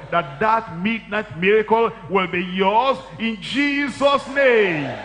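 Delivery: high, loud preacher's crescendo; preaching at full force. Acted. Preacher stirring the congregation, high and loud. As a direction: build it up and hammer it home.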